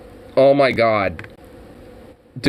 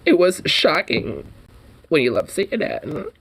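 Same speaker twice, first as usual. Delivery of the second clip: softly voiced, emotional imitation of a host; mock flamboyant; 3 dB quieter than usual. Mimicking the host on Zucker's departure, mock flamboyant. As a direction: put on a mock flamboyant, wounded voice. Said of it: "he actually sounded, like, almost flamboyant-like".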